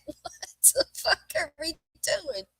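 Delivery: high, slow mock bewilderment; retold incredulous question, drawn out; amused disbelief. Retells amused disbelief at son's complaint.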